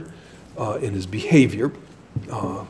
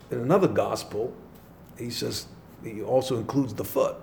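ordinary talk

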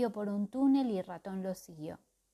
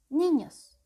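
loud, high booming call; thundering; 6 semitones higher, 10 dB louder than usual. Acted. Calderaus catching the children sneaking off, loud thundering call. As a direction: thunder the word at them. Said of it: "tronó de pronto Calderaus".

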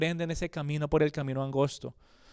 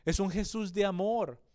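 ordinary delivery

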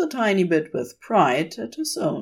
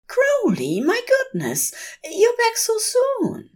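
much higher, delighted angel voice; Aziraphale. A narrator's reading. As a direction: greet him with fussy delight.

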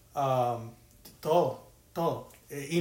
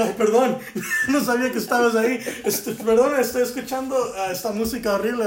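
louder, higher, fast, laughing through it; laughing apology; 6 semitones higher, 8 dB louder than usual. Retold or imitated. Apologising to the startled man while laughing, louder. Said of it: "Y yo".

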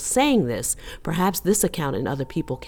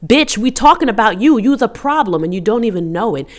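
loud, higher confrontational comeback; mock angry retort; 5 semitones higher, 7 dB louder than usual. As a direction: act out firing back at them. Acted retort to upset account.

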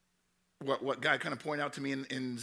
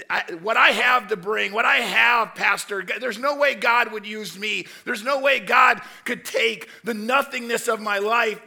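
loud and higher, pleading; protesting believer; 5 semitones higher, 9 dB louder than usual. Retold or imitated. A churchgoer protests to the pastor that God can't use them, loud and pleading. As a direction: protest that God could never use you.

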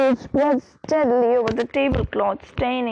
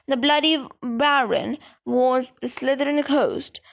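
usual speaking voice

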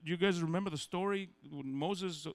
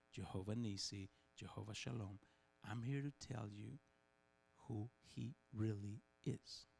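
hushed Jesus voice; 7 semitones lower, 8 dB quieter than usual. Acted. Jesus tells the crowd who God truly is, low and soft. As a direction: draw them in like sharing a secret.

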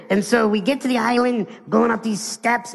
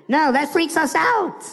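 ordinary delivery

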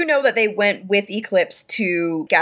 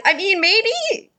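wistful Phineas; 11 semitones higher than usual. Acted. Phineas unsure he can stay at the party, high and wistful. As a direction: stretch out the maybe. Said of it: "And Phineas is like".

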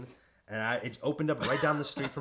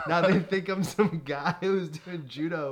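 higher, louder and slow, with a laughing groan; hammed-up embarrassed complaint; mock embarrassment; 8 semitones higher, 10 dB louder, slower than usual. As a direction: drag it out in comic despair. Self voiced, embarrassed and laughing.

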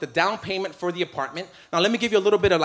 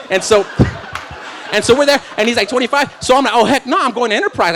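excited retelling; 6 semitones higher, 7 dB louder than usual. Acted. Rental car story rising.

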